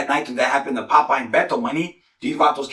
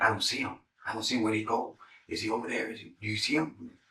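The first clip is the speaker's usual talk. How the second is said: hushed, softer searching voices; whispered lookouts; whispering; 11 dB quieter than usual. Retold or imitated. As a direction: mimic men whispering in the dark. Retold whispering pursuers.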